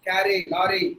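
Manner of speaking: "carry, lorry" with tongue-tapped R; Indian-English R. Shown as a demonstration. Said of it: "That is how we pronounce the letter R". ("carry, lorry" Tamil ர ஒலியுடன்)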